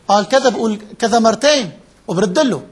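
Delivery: ordinary talk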